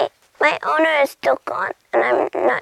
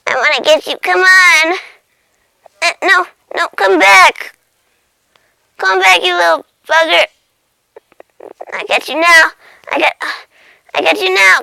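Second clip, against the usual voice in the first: loud, high, eager shouting; desperate chase; 4 semitones higher, 8 dB louder than usual. Acted. Cat losing the butterfly it wants, desperate shouting. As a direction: yell after it, begging it back.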